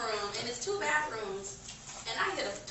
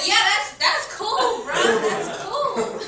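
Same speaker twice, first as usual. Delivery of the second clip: higher, laughing fake-okay; sarcastic cool; 9 semitones higher, 8 dB louder, much slower than usual. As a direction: say it's fine while clearly not fine. Sarcastic reply to roommate.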